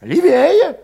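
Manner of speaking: put-on high, agitated young cossack voice; Valet voiced high. A narrator's reading. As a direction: push it up high and restless.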